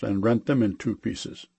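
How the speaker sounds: ordinary delivery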